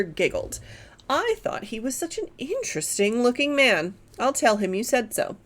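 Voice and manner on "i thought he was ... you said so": ordinary delivery, nothing marked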